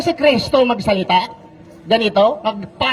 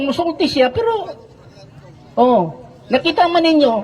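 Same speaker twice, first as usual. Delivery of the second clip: usual speaking voice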